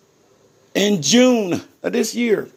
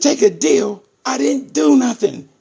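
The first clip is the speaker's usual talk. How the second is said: louder and higher, outraged at the offer; retold indignant outburst at his lawyer; indignant disbelief; 6 semitones higher, 4 dB louder than usual. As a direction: throw it back at her in disbelief.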